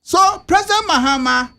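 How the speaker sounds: ordinary talk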